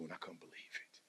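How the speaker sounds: awed breathy whisper. Real, not acted. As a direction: whisper it in disbelief.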